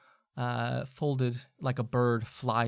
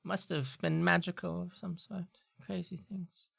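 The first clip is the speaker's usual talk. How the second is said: professor muttering; 6 semitones higher than usual. Acted. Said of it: "she looks over and she goes".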